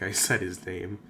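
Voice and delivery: usual speaking voice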